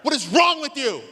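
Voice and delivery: furious brother blaming you for the wasp; angry accusation. Retold or imitated. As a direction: jab the words at him like a finger in the face. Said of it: "and he goes".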